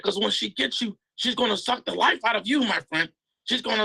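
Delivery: Mario promising a surprise to his friend, in a high put-on voice; Mario's voice. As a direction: play Mario promising a special favour. Mario continuing.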